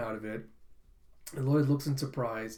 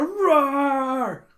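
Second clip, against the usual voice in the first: high, loud, drawn-out roar; battle roar; 9 semitones higher, 9 dB louder than usual. Retold or imitated. Girl launches into her quiz, roaring. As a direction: let the word swell into a mock war cry.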